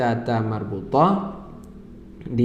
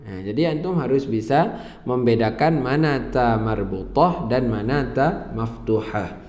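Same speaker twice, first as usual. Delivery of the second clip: usual speaking voice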